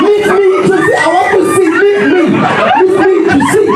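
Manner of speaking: high, hammed-up pleading cry; mock protest. Acted protest at wife covering eyes.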